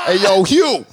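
playful shout to get attention; mock calling out. Friend pretends to hail someone called Hugh, mock calling out. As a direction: holler the name as a joke.

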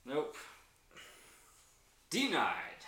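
high, loud verdict on a failed roll; gloating announcement. Real, not acted. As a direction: call it out with relish. Game master announces failed roll.

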